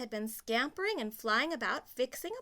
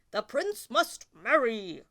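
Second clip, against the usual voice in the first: louder, slow royal voice; king; 8 dB louder than usual. Acted. King to the Grand Duke, slow and loud. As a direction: proclaim it like a king. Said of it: "said the king".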